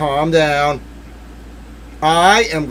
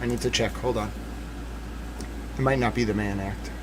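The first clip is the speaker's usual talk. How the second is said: much softer and lower, to herself; muttered check; 5 semitones lower, 11 dB quieter than usual. Real, not acted. Streamer checking the law, low and hushed. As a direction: mumble while checking the document. Double-checking under breath.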